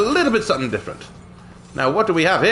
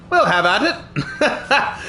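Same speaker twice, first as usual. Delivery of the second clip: laughing; 4 semitones higher, much slower than usual. Acted. Gloating joke about claiming land.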